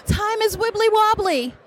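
high, giddy sing-song; giddy fangirl. Real, not acted. Fan quoting Doctor Who while waiting in line, high giddy delivery. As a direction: babble it giddily. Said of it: "I was speaking ridiculously".

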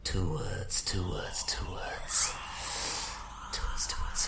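soft repeated eerie whisper. Acted. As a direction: chant it under your breath. Chanting whispered words.

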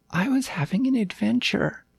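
put-on high, childlike storytelling voice; earnest little puppy. A narrator's reading. Puppy recounting his adventure to the king, high and earnest. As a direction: ramble through it like a small high-voiced pup.

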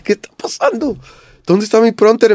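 high, loud panicked yell; a high, frantic call for the prompter; panicked cry. Retold or imitated. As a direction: shout it in sudden stage panic. Panicking on stage, no sound.

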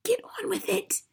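high, whispered hiss; urgent stage whisper; impatient hiss. A narrator's reading. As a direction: hiss at him to get moving. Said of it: "hissed the mother".

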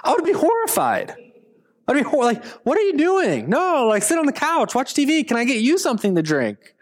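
hammed-up horror; mock horrified. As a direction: fluster as the star scrubs your toilet. Horrified at Taylor cleaning.

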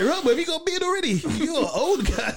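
high, fast laughing jibe; mock the bearded guy; mocking tease. Kids teasing bearded teen.

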